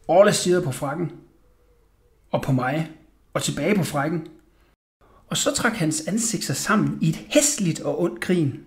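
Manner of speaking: ordinary delivery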